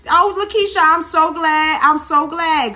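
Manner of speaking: high, loud delighted shout-out to a viewer; light up as you greet her by name; excited greeting. Real, not acted.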